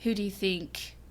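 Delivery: quiet, understated character reply; Loki. Acted. Fan voicing Loki answering Thor, soft and understated. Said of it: "Loki's like".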